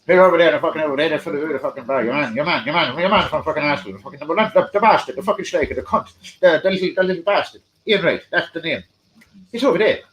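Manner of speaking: fast, loud Irish barman rant; hammed-up Irish barman, spilling out the words; Irish barman accent. Barman shouting across the bar that Ian Wright's there, Irish accent. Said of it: "that's actually how he spoke".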